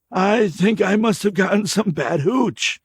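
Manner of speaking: high, loud, sheepish excuse; hungover drifter. Acted. Stranger blaming bad hooch, sheepish and loud. Dirty man's voice.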